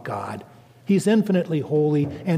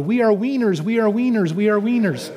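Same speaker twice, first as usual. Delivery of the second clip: higher chanted cheer in a mock Spanish accent, "winners" as "wieners"; 6 semitones higher than usual. Girls cheering in the gym, Spanish-accented chant. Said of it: "cheer in their Spanish dialect".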